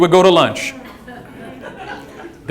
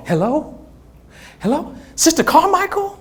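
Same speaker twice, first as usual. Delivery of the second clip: soft, higher-pitched questioning phone voice; imitated mom on phone; 8 semitones higher, 7 dB quieter than usual. Mom answers Sister Carmichael's phone call, soft questioning voice. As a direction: answer softly as mom, rising on each question.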